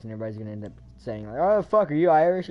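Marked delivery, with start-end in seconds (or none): 1.4-2.5 a mock Irish lilt, loud and high; playful put-on Irish voice; Irish accent. Imagined viewer shouting in an Irish accent. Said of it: "speaking, like, Irish".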